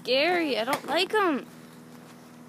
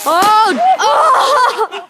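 startled shriek; 10 semitones higher, 9 dB louder than usual. Real, not acted. Firework goes off nearby.

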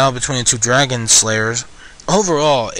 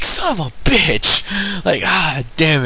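frustrated outburst; 4 semitones higher, 4 dB louder, much slower than usual. Real, not acted. Trolled by the cliffhanger ending.

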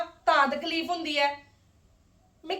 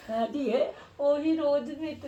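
softer, slow, choked-up lament; tearful daughter; 3 semitones lower, 8 dB quieter than usual. Acted. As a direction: whimper it out to mother, slower and much softer. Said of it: "ਕਿਉਂ ਰੋਂਦੀ ਪਈ ਹੈ?". (ਰੋਂਦੀ ਆਵਾਜ਼ ਵਿੱਚ ਹੌਲੀ ਸ਼ਿਕਾਇਤ)

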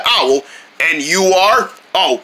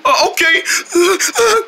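higher, hesitant, nervous stammer; 9 semitones higher than usual. Acted. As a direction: back off uneasily, voice jumping up. Backs off from angry owl.

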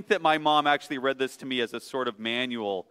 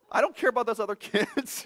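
higher, fast mock-careless mother; mock mom; 9 semitones higher, faster than usual. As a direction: toss it off as an uncaring mom, half laughing. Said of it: "my mom is very much".

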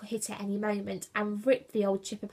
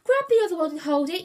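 loud, fast, raised yell; 7 semitones higher, 8 dB louder, faster than usual. A narrator's reading. Julius to crew at the tiller, yelled. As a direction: yell an urgent instruction. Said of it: "yelled Julius".

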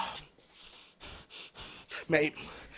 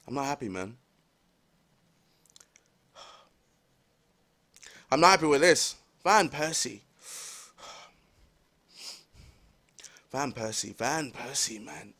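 very low, hushed disappointment; dejected; 12 semitones lower, 11 dB quieter than usual. Real, not acted. Fan lamenting to Van Persie, dejected. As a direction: mumble it low and drained, with long pauses.

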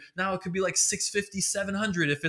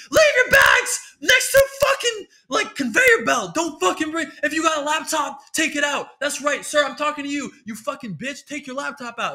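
much higher, louder furious shouting; screaming TSA agent; 10 semitones higher, 8 dB louder than usual. Retold or imitated. Comic mocks TSA agent screaming at passengers, furious shout. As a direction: bark orders like an enraged guard. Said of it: "so fucking angry".

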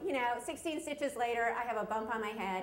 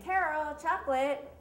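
mock mom's yell, higher and louder; retold yell; 5 semitones higher, 6 dB louder than usual. Mom yells to her child from the kitchen, retold yell. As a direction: call out like a mom summoning her kid. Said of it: "my mom yells from the kitchen".

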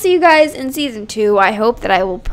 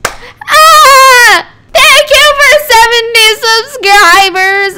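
higher, louder, slow shouted thanks; excited shout; 9 semitones higher, 8 dB louder, much slower than usual. Real, not acted. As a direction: yell the thanks with joy. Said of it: "Wait, no, I didn't".

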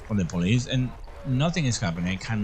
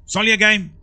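higher, louder, half-laughing protest; loud exasperation; 10 semitones higher, 6 dB louder, faster than usual. Real, not acted. Gamer reassuring his viewers and himself, a loud exasperated protest. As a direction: throw it out to shake off the fright.

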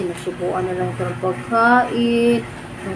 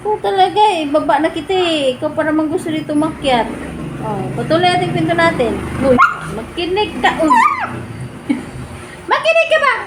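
high pet talk; 7 semitones higher than usual. Real, not acted. Owner coaxing her annoyed cat to listen, in high baby talk.

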